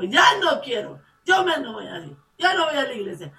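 high, loud, fed-up protest; giving up. Retold or imitated. Preacher recalling wanting to quit church, loud and high. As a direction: complain like you're done. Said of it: "que digo".

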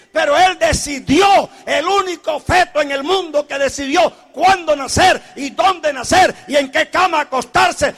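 loud, climbing sermon shout; fiery pulpit proclamation; preaching at full force. Acted. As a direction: drive it home to the church.

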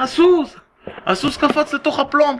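high, frantic storyteller's outcry; acted alarmed cry. Horse owner to the gathering crowd, crying out in alarm. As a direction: cry out the impossible news in alarm. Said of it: "הוא מתחיל לצעוק".

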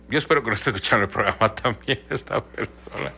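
breaking into laughter while talking; laughter bubbling up through the words; amused, laughing. Real, not acted. Amused aside about the person.

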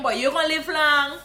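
slow, loud Guyanese Creole blessing; Guyanese greeting. Shown as a demonstration. Guyanese teacher greeting a friend just thought of. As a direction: bless them warmly, drawn-out. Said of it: "Me just had my mind pan you".

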